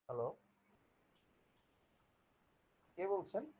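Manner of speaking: quiet side call away from the mic; talking into a phone, off mic; over the phone. Real, not acted. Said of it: "sorry, একটা call আসছিল".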